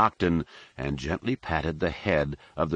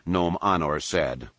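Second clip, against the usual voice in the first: ordinary delivery, nothing marked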